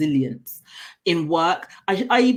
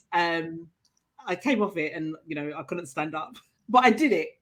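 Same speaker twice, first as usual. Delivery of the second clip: normal talking voice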